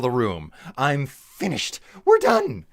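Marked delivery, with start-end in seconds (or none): 2.0-2.7 very high, squeaky "We're done"; squeaky sign-off. Real, not acted. Streamer squeaking a playful end to the stream. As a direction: pop the words up in a playful squeak. Signing off.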